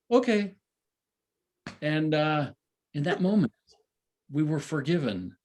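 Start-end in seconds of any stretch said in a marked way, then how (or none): 0.1-0.5 Baba's okay. Retold or imitated. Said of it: "he goes".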